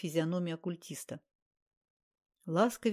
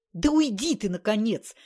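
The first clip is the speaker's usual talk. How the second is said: higher, louder yell to get out; shouting; 4 semitones higher, 7 dB louder than usual. A narrator's reading. The fed-up occultist yelling at Andrey to leave. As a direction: drive him out with a shout. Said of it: "закричал он".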